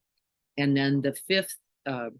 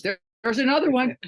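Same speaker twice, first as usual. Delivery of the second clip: high, loud and fast spotting a car; husband spotting a Bronco, imitated high and loud; excited husband; 11 semitones higher, 6 dB louder, faster than usual. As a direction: point it out with a high, eager burst. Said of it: "my husband goes".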